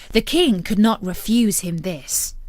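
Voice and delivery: usual speaking voice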